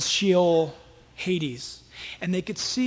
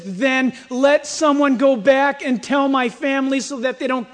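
loud, raised-pitch plea; urgent pleading; 6 semitones higher, 8 dB louder than usual. Acted. Man in hell begging that his family be warned, pleading loudly. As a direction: plead forcefully for those left behind.

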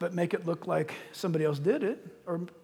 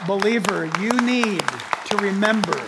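emphatic exhortation; 7 dB louder than usual. Real, not acted. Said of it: "preacher exhorting the congregation".

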